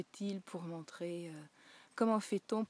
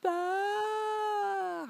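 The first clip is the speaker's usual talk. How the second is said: much higher, very slow drawn-out wail; imitated child sobbing, much higher and louder; crying child; 8 dB louder, much slower than usual. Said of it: "il pleure".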